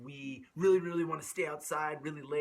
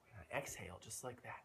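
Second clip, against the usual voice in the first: usual speaking voice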